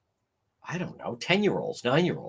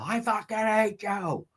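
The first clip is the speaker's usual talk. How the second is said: imitating a drunken voicemail rant; mild mock yell; mock scream; 7 semitones higher, 4 dB quieter than usual. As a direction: quote her rant half-hearted. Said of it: "she's screaming in the voicemail".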